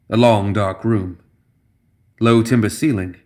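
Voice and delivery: usual speaking voice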